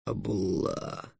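slow, soft dragon voice; hushed dragon afterthought; subdued dragon. A narrator's reading. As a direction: draw the name out quietly. Dragon offers a short name.

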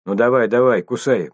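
usual speaking voice

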